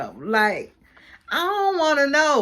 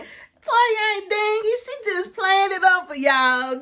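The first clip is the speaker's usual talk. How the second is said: defending Toya; 10 semitones higher than usual. Retold or imitated. Recapping the show, the vlogger defends Toya in a high mock voice. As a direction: stretch out Toya's name, sticking up for her. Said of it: "I'm like".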